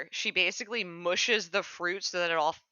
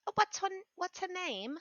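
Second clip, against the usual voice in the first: sweet character voice; 8 semitones higher, 3 dB quieter than usual. Acted. Player's character voice.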